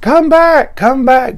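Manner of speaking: high, loud mock scream; imitated screaming. People screaming to bring back a dying patient, imitated scream. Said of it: "these people are screaming".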